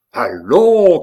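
high, loud drawn-out cry; ringing, exasperated call; impatient shout. A narrator's reading. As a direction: shout to hurry them along. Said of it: "crièrent impatiemment Mayenne et la duchesse".

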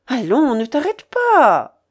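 loud, drawn-out urging; crying out. A narrator's reading. Babouchka urges her porters onward, crying out. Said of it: "criait la babouchka".

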